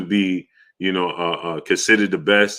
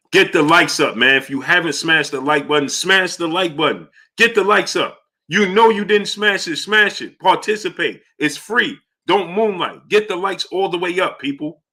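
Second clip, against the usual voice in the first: high-pitched begging; 8 semitones higher than usual. Real, not acted. Pleading with viewers for likes.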